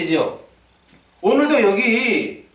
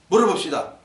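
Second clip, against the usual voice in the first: ordinary talk, nothing marked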